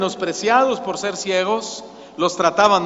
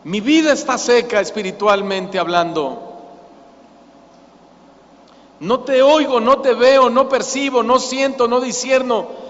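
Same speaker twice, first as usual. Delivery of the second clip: raised, urgent confession to God; crying prayer; 3 semitones higher than usual. Acted. Lukewarm believer cries to God, raised and desperate. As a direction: cry out your spiritual dryness. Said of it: "le clama a Dios y le dice".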